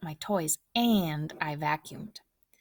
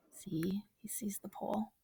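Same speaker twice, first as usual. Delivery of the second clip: soft unvoiced whisper; whispered aside; 11 dB quieter than usual. Real, not acted. Reader whispers an aside about the picture, unvoiced. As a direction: whisper it so only the listener hears. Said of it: "aside to listener, whispered".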